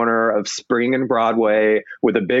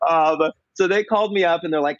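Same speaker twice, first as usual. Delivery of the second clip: ordinary delivery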